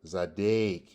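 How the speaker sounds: Zadek drawn to "dake"; A sound. Shown as a demonstration. Teacher points out the vowel, Zadek with an A sound. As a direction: bring out the long A in Zadek. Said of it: "A sound".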